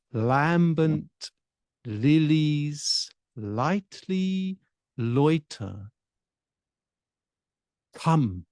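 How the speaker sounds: "Mises miss immense essentials" slowly, each word shaped on its own; speech exercise. Shown as a demonstration. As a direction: articulate each word of "lambent lilies lightly loiter" deliberately, with pauses between. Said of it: "speech exercises can help us iron out our accents".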